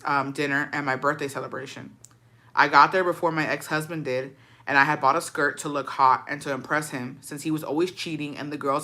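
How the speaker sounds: even read-aloud with little pitch movement; story read aloud. A narrator's reading. Reading a follower's ex-husband story, steady narration.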